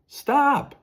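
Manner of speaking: high, loud call from a distance; loud high cry of stop; yelling. Acted. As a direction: yell after them to halt. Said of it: "someone yelled".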